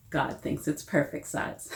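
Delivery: ordinary delivery, nothing marked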